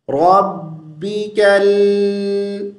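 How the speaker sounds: slow, drawn-out melodic tilawat; Quran recitation. Acted. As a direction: recite slowly with a long melodic line. (آہستہ، کھینچ کر، سریلی تلاوت)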